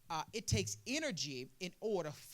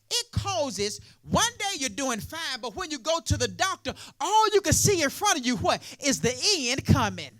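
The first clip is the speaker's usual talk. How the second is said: loud, rising call-and-response sermon, much louder; full-force preaching; 5 semitones higher, 10 dB louder than usual. Acted. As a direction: preach it hard, pulling the crowd along.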